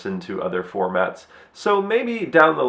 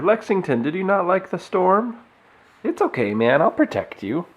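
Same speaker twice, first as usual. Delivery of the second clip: pet voice; 8 semitones higher than usual. Real, not acted. Talking to the scared cat.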